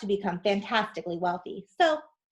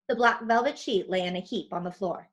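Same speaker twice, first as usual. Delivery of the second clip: ordinary delivery, nothing marked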